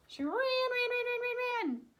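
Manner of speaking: ran ran ran. A narrator's reading. Rushing escape narration.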